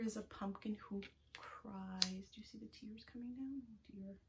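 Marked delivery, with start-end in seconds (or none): 2.3-4.1 hushed whisper. Acted. Sad pumpkin.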